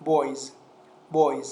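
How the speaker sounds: put-on "Boys" with the last s said as z; final S as Z. Shown as a demonstration. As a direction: demonstrate "Boys" firmly, falling, ending in z.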